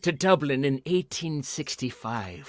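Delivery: slow leprechaun brogue; drawn-out Irish ghost voice. Retold or imitated. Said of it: "And he's like".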